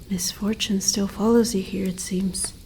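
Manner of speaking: soft unvoiced whisper, fading out; whispered aside about the stranger's luck. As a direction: muse it under your breath. Creature muses about the human's fate.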